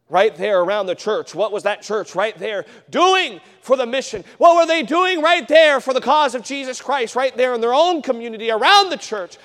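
loud, high, driving sermon delivery; fiery preacher calling the church to act; preaching at full force. Acted. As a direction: hammer the point home to the congregation. Preaching builds on home missions.

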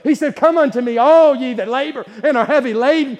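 loud, high, slow preaching cry; full-force preaching. Acted. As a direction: call them in at the top of the voice.

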